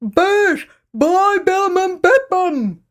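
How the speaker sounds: slow high loud "buy bum and bed bum"; toothless plea. Acted. Grandad nods and urges the girls, toothless plea. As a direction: plead with no teeth, dragging it out.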